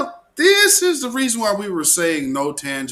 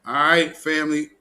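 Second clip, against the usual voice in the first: usual speaking voice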